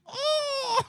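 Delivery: very high mock accent squeal, laughing; mocking Eric. Student repeating his taunt before dad, mocking accent. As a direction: re-enact the silly accent wail through laughter. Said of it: "I walked up to my friend Eric and I went".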